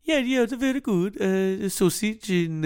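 high sing-song Nordic voice; lilting Norwegian host voice; Nordic accent. Retold or imitated. Nordic accent, playing a Norwegian for friends. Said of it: "like a Nordic accent".

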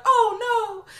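high, loud hammed-up cry; overacted high wail; mock whimper. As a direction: wail it like a fake fall. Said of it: "he's on the ground like".

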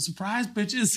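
spring it on the crowd; stage surprise. Retold or imitated. Comedian greets a shocked military crowd, gleeful surprise.

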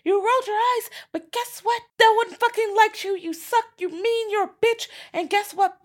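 very high-pitched imitated rant; mock angry tirade. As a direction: tear into a friend, in a high put-on voice. Acted quarrel, telling off the eye-roller.

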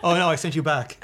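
normal talking voice

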